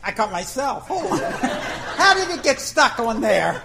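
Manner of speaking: cracking up at his own mishap; tangled in the net, laughing at himself. Real, not acted.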